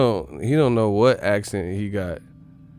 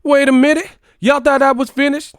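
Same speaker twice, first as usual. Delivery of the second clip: hype catchphrase; 10 dB louder than usual. Acted. Sudden high loud outburst of borrowed line.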